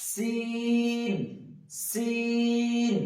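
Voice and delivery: সিন sung out very slowly, the hiss held long; Arabic letter সিন chanted slowly with a clear whistle; drawn-out letter demo. Shown as a demonstration. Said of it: "আমার সঙ্গে সঙ্গে আপনারা বলুন".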